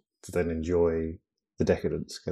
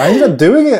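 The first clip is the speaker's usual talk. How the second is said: loud, high, through laughter; laughing confession; 14 semitones higher, 15 dB louder than usual. Real, not acted. Telling the host the cab outcome, laughing. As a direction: confess it while cracking up.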